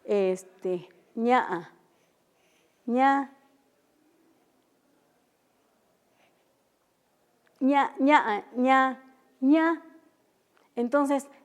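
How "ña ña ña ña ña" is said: "ña" repetido subiendo el tono; tonos mixtecos. Shown as a demonstration. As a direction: cada "ña" un poco más alto. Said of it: "Una vez más lo voy a hacer". (repite "ña" marcando el tono)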